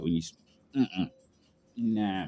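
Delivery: usual speaking voice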